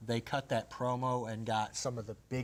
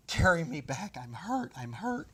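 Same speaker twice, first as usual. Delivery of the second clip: raised-pitch mock cries of pain; imitated hurt plea; 5 semitones higher, 5 dB louder than usual. Retelling an injured manager begging for help, mock pained plea. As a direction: plead like you're injured, playing it up for the story.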